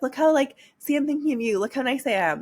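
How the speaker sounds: high, eager, mocking people-pleaser voice; mock sweet self. Imitating her old self sending him gifts, in a high mock-sweet voice. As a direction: parody her own needy eagerness. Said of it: "to be like".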